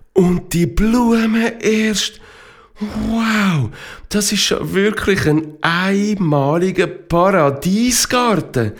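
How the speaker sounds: high, drawn-out marvelling; awestruck delight. A narrator's reading. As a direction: gush in wonder at the view. Narrator voices wonder at the flowers.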